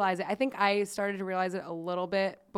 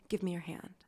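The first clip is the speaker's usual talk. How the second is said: soft quote; 2 semitones lower, 7 dB quieter than usual. Retold or imitated. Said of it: "A S M R".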